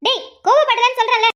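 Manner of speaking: high, loud exasperated snap; wife yells she isn't angry; angry retort. Acted. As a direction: shut him down, fed up. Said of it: "நீ கோவப்படுற".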